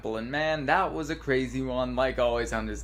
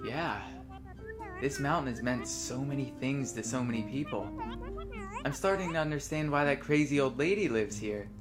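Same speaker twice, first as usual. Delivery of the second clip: softly reading dialogue lines aloud; reading game dialogue; 5 dB quieter than usual. Acted. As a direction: read the lines like a script. Reads both characters' dialogue.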